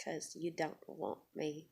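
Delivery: very soft and low, almost under the breath; quiet warning. Retold or imitated. Worker warning the manager off, very quietly. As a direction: imitate a restrained warning, barely voiced.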